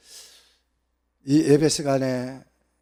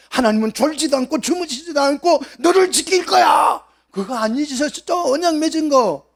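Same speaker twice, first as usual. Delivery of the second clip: much higher, loud ringing proclamation; proclaiming shout; 10 semitones higher, 7 dB louder than usual. Acted. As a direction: shout the promise out to the whole church. Proclaiming God's promise. (높고 크게 외치듯 선포)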